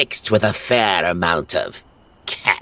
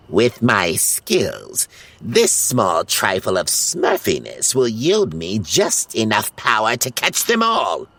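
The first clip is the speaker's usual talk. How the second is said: scheming villain; 5 semitones higher than usual. Acted. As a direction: savour the evil plan. Gargamel plots aloud.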